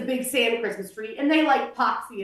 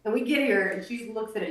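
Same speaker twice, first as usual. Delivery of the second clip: normal talking voice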